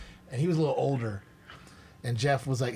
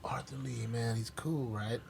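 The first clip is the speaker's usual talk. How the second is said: soft mumbled Val Kilmer line; 8 dB quieter, slower than usual. Retold or imitated. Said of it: "Jim's character is like".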